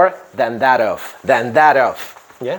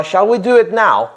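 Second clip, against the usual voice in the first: bright, higher friendly question; teacher voicing a party guest, higher and eager; party guest asking; 7 semitones higher than usual.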